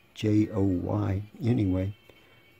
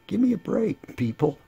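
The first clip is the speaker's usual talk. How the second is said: exasperated; 11 semitones higher, 4 dB louder than usual. Real, not acted. To his viewers about young admirers, exasperated and pitched high. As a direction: give me a break, high and louder. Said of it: "exasperated aside to viewers".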